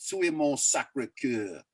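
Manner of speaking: put-on slow, rehearsed French in an American accent; lightly put-on American French accent. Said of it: "a shitty American French accent".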